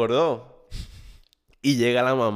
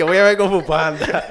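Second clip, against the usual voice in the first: laughing sly excuse; 5 semitones higher, 7 dB louder than usual. Retold or imitated.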